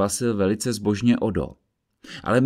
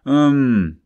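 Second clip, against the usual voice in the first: offended tone; 5 semitones higher, 8 dB louder than usual. Acted. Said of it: "odvětil Odo uraženým tónem".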